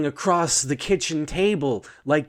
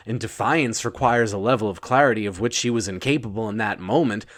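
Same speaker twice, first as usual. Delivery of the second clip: usual speaking voice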